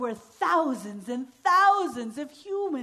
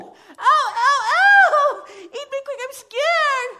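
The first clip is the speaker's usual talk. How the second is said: high, loud ow-ow cries; scared girl cries; 13 semitones higher, 11 dB louder, slower than usual. Acted. Girl inside the drum crying out in fright.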